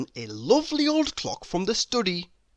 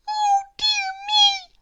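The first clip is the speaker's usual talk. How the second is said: very high tiny fretful voice; worried little mouse; 4 dB louder than usual. A narrator's reading. Mouse fretting that the man won't wake. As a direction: fret in a squeaky mouse voice. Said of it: "thought John Mouse".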